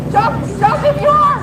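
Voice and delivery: high mocking taunt. Real, not acted. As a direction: goad them from across. Taunting officers.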